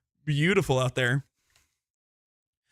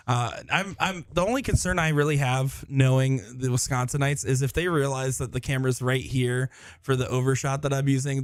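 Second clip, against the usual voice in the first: usual speaking voice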